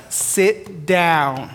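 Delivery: hint of a calm divine voice, unhurried; God's gentle prompt. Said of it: "And God's like".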